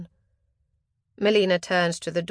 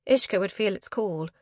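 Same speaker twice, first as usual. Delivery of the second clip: ordinary delivery, nothing marked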